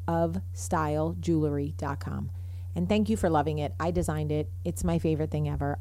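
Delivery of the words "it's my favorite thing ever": normal talking voice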